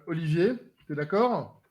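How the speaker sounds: ordinary talk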